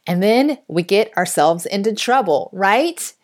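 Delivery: ordinary talk, nothing marked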